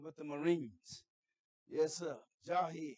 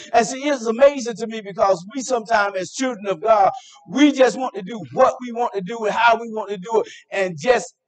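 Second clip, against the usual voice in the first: loud, driving preacher's cadence; full-force preaching; 3 semitones higher, 25 dB louder than usual. Acted. Preacher exhorting the congregation about God's order, shouting. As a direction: build the sermon like a rally cry.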